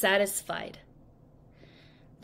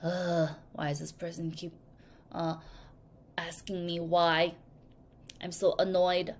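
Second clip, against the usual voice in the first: low, softer fed-up grumble; annoyed; 5 semitones lower, 7 dB quieter than usual. Retold or imitated. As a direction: sigh it out like someone who has heard why too many times. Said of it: "because they're like".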